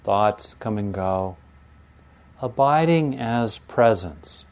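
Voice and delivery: slow, calm meditation guide; guided meditation. Acted. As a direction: ease them in slowly, letting go. Opening guided meditation lines.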